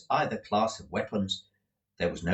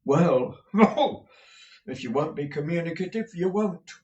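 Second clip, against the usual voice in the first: huffy; 8 semitones higher, 3 dB louder than usual. A narrator's reading. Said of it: "as much as to say".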